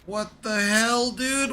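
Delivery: loud, high startled yell; shocked shout. Real, not acted. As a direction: yelp it out in shock. Sudden shock in game.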